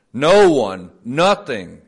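loud, punched denial; emphatic declaration. Acted. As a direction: slam each word down.

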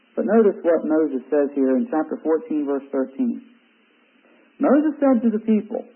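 ordinary delivery

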